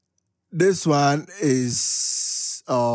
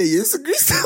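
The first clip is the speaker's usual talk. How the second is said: laughing; 12 semitones higher than usual. Retold or imitated. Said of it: "he was like".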